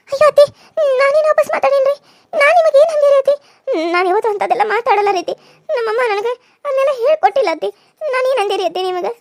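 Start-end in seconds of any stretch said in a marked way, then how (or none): 0.1-3.3 high, loud, wailing protest to mother-in-law; pleading cry. Acted. Scolded daughter-in-law protests. 3.9-7.7 tearful. Acted. Daughter-in-law cries when scolded.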